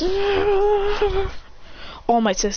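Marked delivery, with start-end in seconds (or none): 0.0-1.3 a short high-pitched cry. Retold or imitated. Villain hit by wind blast, high cry. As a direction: yelp it way up high. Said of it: "He goes".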